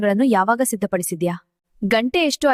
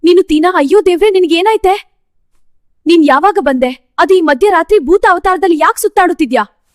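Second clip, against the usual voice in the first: loud, high-pitched disbelief; shocked scolding; 7 semitones higher, 7 dB louder than usual. Acted. Startled woman scolding sister for ghostly midnight look, shocked. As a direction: scold her at full raised voice.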